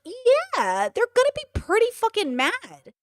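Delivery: high-pitched, incredulous comeback; incredulous retort. Retold or imitated. Streamer mocking Ethan's worry that they might be mad, incredulous. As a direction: push the pitch way up in disbelief.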